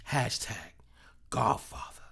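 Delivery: hushed whispered sign-off; whispered Hashtag Godfather. Retold or imitated. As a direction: breathe it out like a secret. Signing off quoted post.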